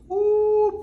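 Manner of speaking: high-pitched scandalized whoop; playful shocked squeal; high loud "ooh". Pretend. Mock scandalized reaction to royals talk.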